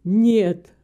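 firm protest, loud on each no; objecting. A narrator's reading. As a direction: push back emphatically, dropping low on the name. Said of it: "возразил он". (твёрдое возражение, с нажимом)

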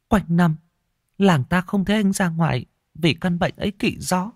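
pleading with fear in her voice; frightened young wife. A narrator's reading. Scared daughter begs her father to take her home, high and tearful. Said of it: "cô mới thì thào".

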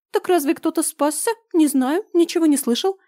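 high squeaky scared babble; frightened babbling. Acted. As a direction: stammer out a frightened denial. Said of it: "испуганно залепетал Фрогер".

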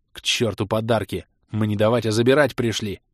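ordinary delivery

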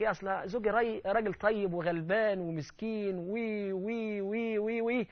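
slow, whimpering mock; mocking whiny voice. Preacher mocking a wife excusing her husband, whiny. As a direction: drag it out pitifully. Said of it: "واحد يقول لك".

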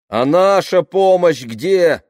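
loud, high, drawn-out demand; demanding call. A narrator's reading. Latecomers behind the van demanding their aid, loud demanding call. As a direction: call out indignantly from the back. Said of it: "раздалось сзади".

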